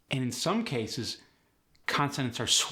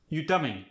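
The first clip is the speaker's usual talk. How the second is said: "coming" falls at the end; Khmer-style question, "coming" going down; no rising tone; 3 semitones higher than usual. Shown as a demonstration. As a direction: drop the pitch on "coming" despite the question. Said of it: "No rising tone".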